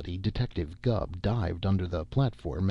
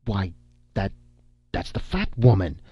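halting, then higher-pitched astonished outburst; acted shock, breaking off and restarting; gasping exclamation; 3 semitones higher than usual. A narrator's reading. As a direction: stammer it out in shocked disbelief. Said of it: "looked toward Syrilla and gasped".

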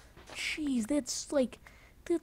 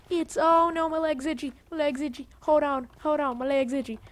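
frantic itch; 4 semitones higher, 8 dB louder than usual. Real, not acted. Sudden itch panic mid-game.

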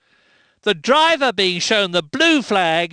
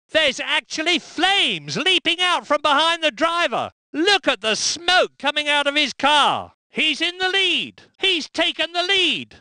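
higher, thrilled race call; excited; 7 semitones higher than usual. Acted. Commentator calling flames and a new leader, excited. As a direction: sell the drama of the moment, pitch up.

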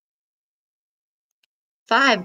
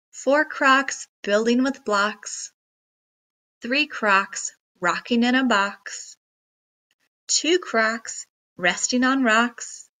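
ordinary delivery

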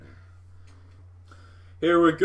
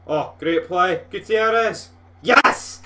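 shouted high and loud as the goal goes in; goal celebration; 6 semitones higher, 10 dB louder, slower than usual. Real, not acted. Gamer celebrating his opening goal against Celtic, ecstatic shouting. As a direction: erupt with joy at the goal.

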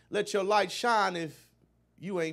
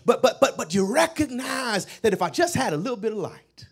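rising, shouted preaching over the organ; full-force preaching; 4 semitones higher, 13 dB louder than usual. Acted. Preacher rallying the congregation, shouting louder and louder. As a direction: build it up, pleading for light.

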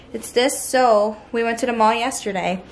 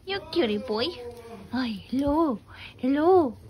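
fond pet voice, high and soft; 4 semitones higher, 4 dB quieter than usual. Real, not acted. Cooing to a pet dog.

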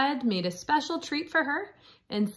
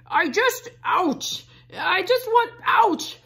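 high, loud ouches from a hurt wolf; wolf in pain; 9 semitones higher, 6 dB louder than usual. Acted. Wolf pelted with rocks by Red, yelping. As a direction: yelp each ouch as a rock lands.

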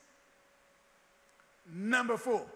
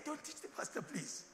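whisper; 3 semitones higher, 10 dB quieter than usual. Shown as a demonstration.

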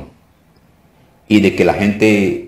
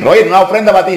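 high, raised put-on voice; imagined grateful client; 11 semitones higher, 5 dB louder, faster than usual. Client offering the pastor a gift, high playful imitation. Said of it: "No dicen".

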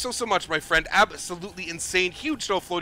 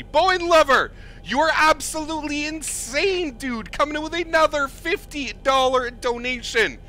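excited; 9 semitones higher than usual. Real, not acted. Streamer praising a repeat big donor, excited. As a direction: call out the donor's name in disbelief. Another big donation from Bowen Lover.

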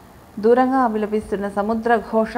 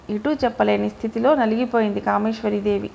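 ordinary delivery, nothing marked

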